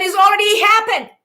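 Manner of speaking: usual speaking voice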